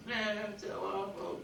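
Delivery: high, feeble mock whine; mock whining. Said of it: "Whine away, whiners".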